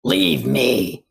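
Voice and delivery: put-on low, loud, snappy old granny; mean grandma voice. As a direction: bark it like a cranky grandma.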